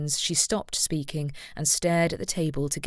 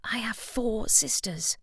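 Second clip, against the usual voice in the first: slow, breathy whisper, higher; hushed confession to her fiancé; 6 semitones higher, 4 dB quieter, slower than usual. A narrator's reading. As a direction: whisper it slowly so only he hears. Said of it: "Deborah whispered".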